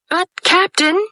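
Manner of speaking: high alarmed pirate protest; protesting cry. A narrator's reading. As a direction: cry out in dismay. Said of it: "cried Smee".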